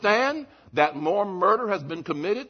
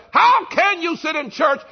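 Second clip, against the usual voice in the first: loud, high challenge; preacher thundering Jesus's rebuke; preaching shout; 6 semitones higher, 6 dB louder than usual. Acted. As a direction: shout the question at the congregation. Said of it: "He says".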